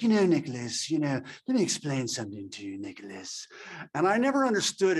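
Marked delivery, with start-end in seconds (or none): 0.0-3.5 a hint of a mid-Atlantic accent, lecturing his son; lightly imitated mid-Atlantic father explaining something; August Coppola's mid-Atlantic accent. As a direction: lightly imitate Dad's mid-Atlantic lilt, patient and instructive. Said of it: "he had a mid-Atlantic accent".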